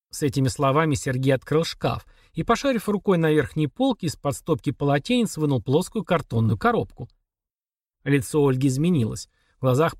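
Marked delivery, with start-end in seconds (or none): none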